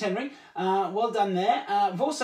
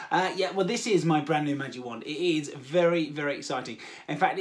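ordinary talk

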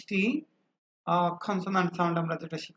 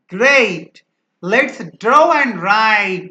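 "Great! Let's draw and write" much louder and slow; loud excited model line; 2 semitones higher, 12 dB louder, much slower than usual. Shown as a demonstration. Friend happily suggests drawing and writing, loud and slow. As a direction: cheer it out slowly like an eager friend. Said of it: "বন্ধু বলল".

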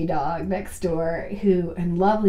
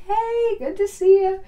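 forced casual greeting; 11 semitones higher than usual. Retold or imitated. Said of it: "I'm like".

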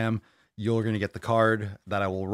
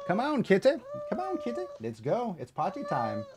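much higher, singsong call to the kitty; high call to cat; 11 semitones higher than usual. Real, not acted. Owner calling the kitty to play, in a high squeaky voice. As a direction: lure the cat in with a rising squeak.

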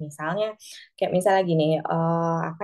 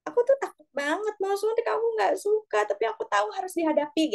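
put-on much higher child voice admitting fear; pitched-up child, mildly scared; 13 semitones higher than usual. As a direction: speak as the frightened kid. Parent voicing scared child.

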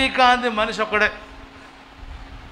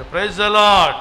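louder burst of Praise the Lord; loud call to praise; 7 dB louder, much slower than usual. Real, not acted. Pastor calling out to his congregation, a louder praise shout. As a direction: call it out to the room.

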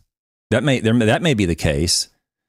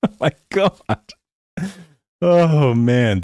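ordinary delivery

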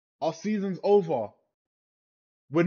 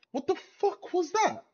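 incredulous high outburst; 12 semitones higher than usual. Real, not acted. Fan raging at the team's performance, incredulous cry. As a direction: voice leaps way up in exasperated disbelief. Fan ranting after bad match.

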